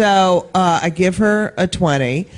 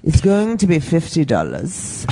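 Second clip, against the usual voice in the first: slow, lower mock German accent; 4 semitones lower, slower than usual. Comic retelling the clerk's fifty-dollar demand, mock German accent. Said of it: "I'll do my German accent".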